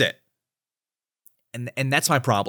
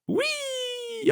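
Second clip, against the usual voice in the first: playful whee; 9 dB quieter than usual. Real, not acted. Playfully enlarging the screenshot.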